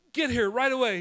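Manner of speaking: high loud panicked command; loud high urgent order to Isaiah. Retold or imitated. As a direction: order him over fast. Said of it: "she's like".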